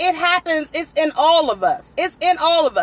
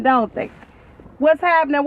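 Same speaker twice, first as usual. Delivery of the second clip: normal talking voice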